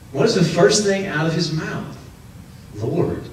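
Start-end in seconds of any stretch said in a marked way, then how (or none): 2.8-3.2 low, hushed awe on Lord; a dazed Lord, breathed out quietly; stunned whisper-soft. Retold or imitated. [name]'s first stunned word.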